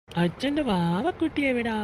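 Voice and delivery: put-on high, slow cooing to a baby; father sweet-talking his baby girl, high cooing. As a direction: coo sweetly to the little one.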